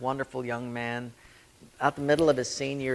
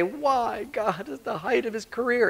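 protesting mourner; 10 semitones higher than usual. Retold or imitated. Grieving person's protest.

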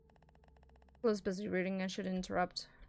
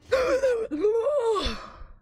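high loud startled shriek; startled gasping scream; 13 semitones higher, 8 dB louder than usual. Real, not acted. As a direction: scream at the jump scare. Jump scare in horror game.

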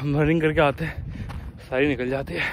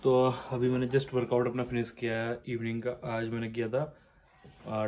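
usual speaking voice

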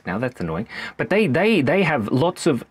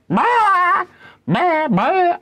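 very high loud bleating bah; scared sheep; 5 dB louder, much slower than usual. Pretend. Frightened sheep bleating in the dark paddock. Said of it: "they're like".

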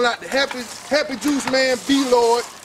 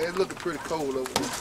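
quiet; 8 semitones lower, 9 dB quieter than usual. Shown as a demonstration.

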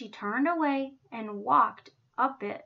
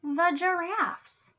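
ordinary delivery

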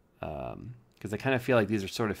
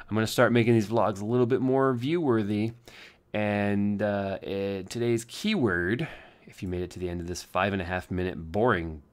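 ordinary talk, nothing marked

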